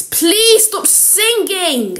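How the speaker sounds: high, drawn-out exasperated plea. Acted. Fed-up friend to the singer, exasperated plea. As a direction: beg them to quit, stretching the words.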